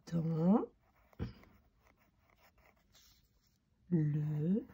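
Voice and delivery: isolated words, stretched as they are written; drawn-out words with long gaps; writing aloud. Shown as a demonstration. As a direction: say each word slowly while writing. Saying words while writing them.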